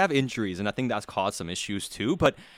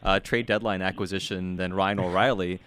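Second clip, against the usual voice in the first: usual speaking voice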